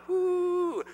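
high, thrilled "woo"; celebratory whoop. Retold or imitated. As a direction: relive the home-run joy in a high whoop. Reliving his first home run.

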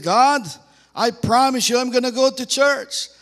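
high, loud, frantic bargaining; desperate pleading. Acted. Dangling hiker begs God to rescue him, desperate pleading. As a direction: plead hard, promising anything.

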